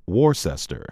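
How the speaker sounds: last Worcester said "WOR-sester"; Worcester in three syllables, "WOR-sess-ter"; mispronounced. Shown as a demonstration. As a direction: say Worcester as spelt with an S sound, "WOR-ses-ter". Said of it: "It is not Worcester or".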